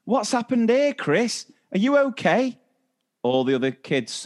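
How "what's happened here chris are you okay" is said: high, worried teacher calling over; teacher's concerned call. Retold or imitated. Teacher calling to upset child.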